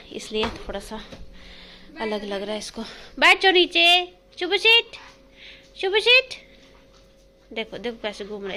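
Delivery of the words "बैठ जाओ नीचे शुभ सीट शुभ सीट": high, loud doggy commands; high pet-talk orders to the dog. Real, not acted. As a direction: coax the puppy to sit down.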